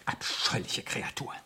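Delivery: low whispered curse; whispered disgust. Acted. Writer alone after the call, a low whispered curse. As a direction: breathe it out with loathing.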